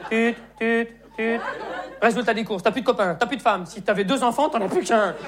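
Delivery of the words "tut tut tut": imitated busy tone. Mimics a phone hanging up.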